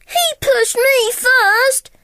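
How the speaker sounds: high, slow whiny pup; whining puppy. Acted. Patch to Lucky, high slow whine. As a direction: whine back defensively. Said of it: "Patch whined".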